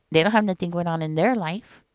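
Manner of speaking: ordinary talk, nothing marked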